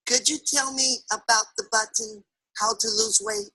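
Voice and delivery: soft, tentative asking; lightly voiced shy shopper, hushed; Mary's timid question. Overweight Mary asks the truck driver for help, timidly.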